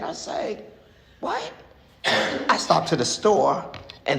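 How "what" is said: high squeaked disbelief; squeaked shock at the driver's offer; shocked what. Retold or imitated. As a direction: jump up in pitch, stunned.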